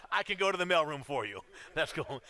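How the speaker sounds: relieved laugh breaking into the reply. Retold or imitated. Laughing while retelling a relieved reply.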